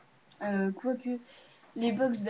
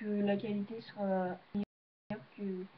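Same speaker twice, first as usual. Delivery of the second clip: ordinary delivery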